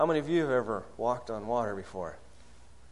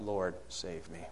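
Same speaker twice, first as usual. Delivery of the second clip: subdued cry for help; 7 semitones lower, 9 dB quieter than usual. Acted. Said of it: "we begin to cry out".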